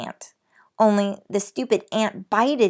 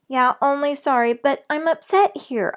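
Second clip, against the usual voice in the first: upset explaining; 6 semitones higher, 4 dB louder than usual. A narrator's reading. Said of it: "I explained".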